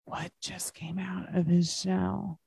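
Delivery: hushed, secretive question; whispered mystery for little listeners; suspense whisper. Acted. Teacher builds suspense for children.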